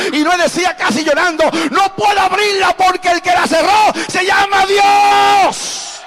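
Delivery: loud, driving Pentecostal preaching; rising sermon fervor, near shouting; full-force preaching. Acted. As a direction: preach it to the rafters, building the crowd up.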